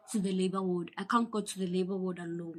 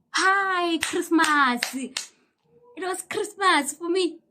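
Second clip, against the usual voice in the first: high, slow, joyful drawl; delighted; 8 semitones higher, 8 dB louder, much slower than usual. Real, not acted. Thrilled to be allowed to the toilet, high and slow. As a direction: savour it like a gift. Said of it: "joy at toilet permission".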